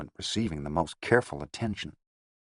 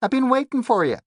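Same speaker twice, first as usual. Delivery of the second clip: high whining Bronx moll; whiny schoolgirl voice; 6 dB louder than usual. A narrator's reading. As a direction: whine like a sulky schoolgirl. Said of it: "whining voice of a schoolgirl".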